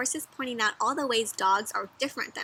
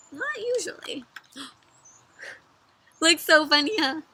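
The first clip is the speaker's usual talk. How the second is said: ordinary delivery, nothing marked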